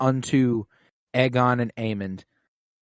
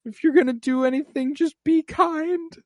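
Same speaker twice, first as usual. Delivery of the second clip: high-voiced earnest plea; Wayman Wang. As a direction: beg gently in a very high voice. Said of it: "he went full Wayman Wang".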